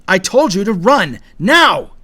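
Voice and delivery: high, very loud command; furious-urgent shouted order; yelled order. A narrator's reading. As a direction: yell it, building to the last word. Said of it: "Conway yelled".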